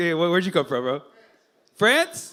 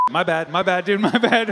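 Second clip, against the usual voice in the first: normal talking voice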